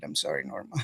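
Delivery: soft mumbled apology; quiet. Real, not acted. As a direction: say it almost to yourself. Apology to absent fan.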